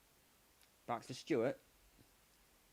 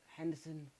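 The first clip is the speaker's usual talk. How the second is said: ordinary talk, nothing marked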